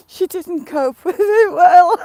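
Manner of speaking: high, loud, slow words squeezed out through laughter; laughing through words. Real, not acted. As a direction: force the words out between laughs. Speaking mid laughing fit.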